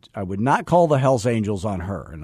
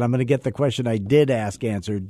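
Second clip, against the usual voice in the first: ordinary talk, nothing marked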